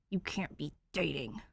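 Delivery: soft, dejected mutter. Acted. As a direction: mutter it, let down. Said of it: "Danny mutters dejectedly".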